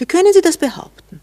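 high, loud, wounded protest; how dare you say that, loud and high; indignant outburst. Acted. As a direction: flare up, stung by the accusation. Indignant reply in lovers' quarrel.

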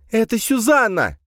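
very high, loud outcry; distraught shout. A narrator's reading. Camille defying the gendarme about Suzanne, distraught shout. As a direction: cry out against the rules at full pitch. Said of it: "выкрикнула Камила".

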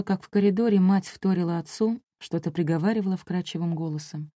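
usual speaking voice

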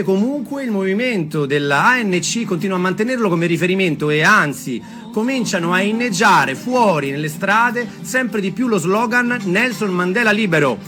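plain reading voice

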